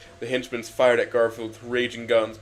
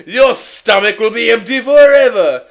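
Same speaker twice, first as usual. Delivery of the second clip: loud, high gloating taunts; hammed-up baddie roar; villain shouting; 10 semitones higher, 16 dB louder, slower than usual. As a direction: bellow threats at the hero. Villain leader taunts Garfield.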